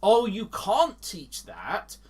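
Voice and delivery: ordinary talk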